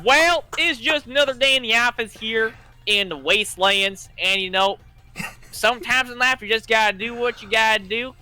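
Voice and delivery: put-on country accent. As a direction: talk like an easygoing farmhand. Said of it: "can you do a country accent for me".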